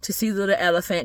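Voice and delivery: plain reading voice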